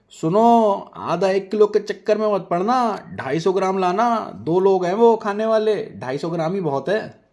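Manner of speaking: loud high call after him; wife calling after her husband, loud. Acted. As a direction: shout the order after him from behind. Nazia calling out after Rehan.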